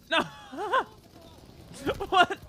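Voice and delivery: loud high what the hell; shocked yell. Real, not acted. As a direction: yell it out in disbelief. Shocked outburst mid match.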